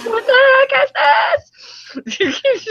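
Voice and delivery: high, loud outraged cry; exasperated shout. Retold or imitated. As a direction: yell it in disbelief. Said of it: "he's like".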